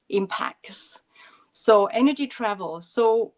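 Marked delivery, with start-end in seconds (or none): 0.1-1.0 whispered for emphasis. Real, not acted. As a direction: drop to a whisper on the last word. Whispered emphasis on key word.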